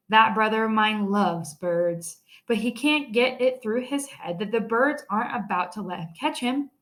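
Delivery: plain reading voice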